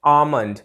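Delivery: आमंड with the L silent; correct almond pronunciation. Shown as a demonstration. Teacher giving learners the correct word, silent L. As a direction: say आमंड, long first vowel straight into M. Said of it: "correct pronunciation है वो है".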